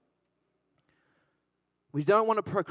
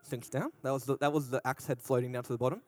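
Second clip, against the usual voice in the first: brash vaudeville comic; 7 semitones lower, 8 dB quieter, faster than usual. Pretend. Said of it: "Yeah, thank you. I'll be here all week".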